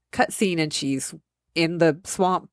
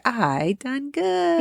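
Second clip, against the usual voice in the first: high, slow proud goon voice; a high slow dopey henchman; happy dim henchman; 8 semitones higher, much slower than usual. Retold or imitated. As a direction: beam like a simple goon. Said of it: "He's the happy boy".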